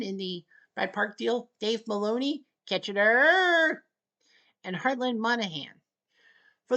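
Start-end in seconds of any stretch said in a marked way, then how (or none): 2.7-3.8 Kitchener drawn out slowly, higher and louder; Kitchener taunt. Acted. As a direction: sing out Kitchener like a cheer. Repeats his Kitchener taunt.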